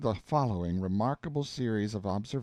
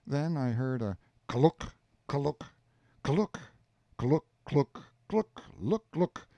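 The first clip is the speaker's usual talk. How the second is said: clucking sound; 4 semitones higher, slower than usual. Acted. Imitating the telephone's clucking.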